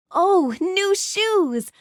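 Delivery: high, slow admiring exclamation; pleased surprise. Acted. Heroine admiring a visitor's new shoes, pleasantly surprised.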